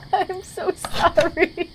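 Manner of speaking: slow, still giggling; laughing apology. Real, not acted. Player apologises to the mentor while laughing. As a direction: say sorry through leftover laughs. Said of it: "while I'm laughing".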